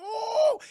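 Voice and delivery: high, loud mock "oh!"; hammed-up high yelp of alarm; startled crowd cry. Crowd reacts to drawn guns.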